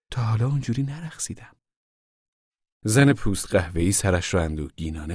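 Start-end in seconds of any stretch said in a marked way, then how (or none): 0.1-1.6 higher, modest denial; virtuous. A narrator's reading. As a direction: disclaim it primly without raising the voice. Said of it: "با لحنی پرهیزکارانه گفت". (با لحنی پرهیزکارانه)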